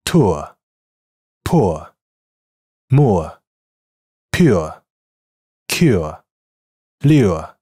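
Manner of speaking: each word clear and separate, like a teacher; ʊə word drill. Shown as a demonstration. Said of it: "再来一遍". (逐词示范带ʊə的单词)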